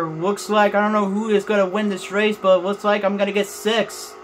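mock NASCAR broadcaster; race announcer call. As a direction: call the last lap like a TV announcer.

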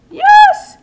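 loud high yelp of triumph; excited cheer. Real, not acted. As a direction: burst out with delight. Another kill celebrated.

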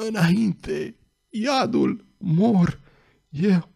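strangled, choking rasp on each word; death rattle. A narrator's reading. The dying thug chokes out his last orders, rasping. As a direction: gasp the words out while being throttled. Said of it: "nu mai fu acum decât un horcăit".

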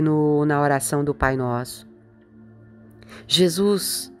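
ordinary talk, nothing marked

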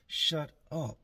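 stern order. Retold or imitated. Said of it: "She tells everybody".

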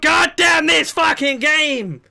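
high, loud, slow drawn-out curse; furious shout. Real, not acted. Raging player curses the game, slow furious shout. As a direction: bellow it at the game.

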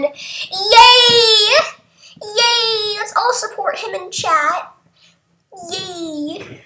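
high, long-held cheer that softens as it goes; high-pitched celebratory yay. Real, not acted. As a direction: cheer for the winner, then ease off. Cheers the winner.

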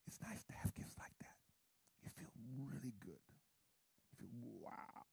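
near-whisper, savouring it; very soft, breathy whisper of pleasure; hushed delight. Real, not acted. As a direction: drop to an awed whisper. Savouring an expensive gift, hushed.

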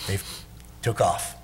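ordinary talk

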